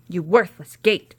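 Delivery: loud furious scream; screaming witch. A narrator's reading. Witch screaming at the gate, loud fury. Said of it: "she screamed".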